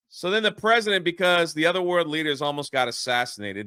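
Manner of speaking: usual speaking voice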